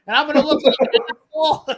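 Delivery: words break into a high, loud laugh on the punchline; cracking up while telling off the office staff; laughing through it. Retold or imitated. Telling off the post office staff, laughing loud and high.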